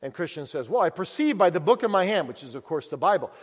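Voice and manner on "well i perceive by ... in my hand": raised, pleading voice of a burdened man; distressed pilgrim. Acted. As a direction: plead it out, full of dread. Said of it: "meets him when he's crying".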